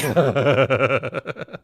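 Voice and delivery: amused laugh. Real, not acted. Joke about control party.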